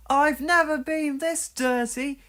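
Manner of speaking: high, dismayed whine; dismayed engine. Acted. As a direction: lament being so dirty. Percy speaking.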